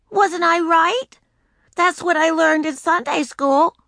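put-on high, slow, unsure little girl; pleading little-girl voice. A narrator's reading. Ramona voiced in story.

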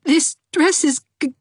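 put-on tearful girl stammering; sobbing. A narrator's reading. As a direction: stutter through sobs. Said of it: "she sobbed".

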